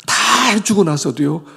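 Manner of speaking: normal talking voice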